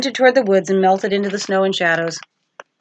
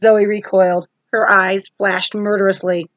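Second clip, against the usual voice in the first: plain reading voice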